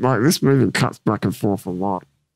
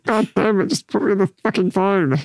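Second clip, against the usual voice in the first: much higher, exasperated mock yell; furious yell; 8 semitones higher than usual. Soldier fed up with the phone salesman, exasperated mock yell. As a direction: blow up at the useless salesman.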